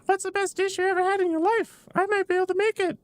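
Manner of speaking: very high, squeaky game character voice; high-pitched child-like character. Acted. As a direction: pitch it way up, young and inquisitive.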